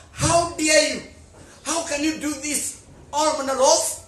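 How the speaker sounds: loud, high furious challenge; angry outburst. Acted. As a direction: storm at her in a rage. Prince storms at his mother.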